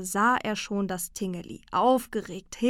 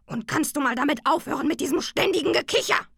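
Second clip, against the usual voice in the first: fast, louder, higher grumpy outburst; annoyed bear; 7 semitones higher, 5 dB louder, faster than usual. A narrator's reading. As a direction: rattle it off in irritation. Bear annoyed by constant giggling. (genervt, laut und schnell)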